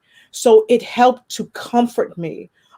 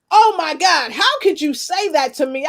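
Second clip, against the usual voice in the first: mock outrage; 9 semitones higher than usual. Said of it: "I was like".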